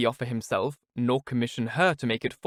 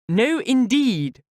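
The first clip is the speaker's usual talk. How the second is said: very high, slow, loud mock protest; affected earnestness; 12 semitones higher, 6 dB louder, much slower than usual. Miss Steele protests to Mrs Jennings, affected earnestness. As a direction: protest far too earnestly. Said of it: "with affected earnestness".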